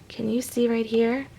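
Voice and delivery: ordinary delivery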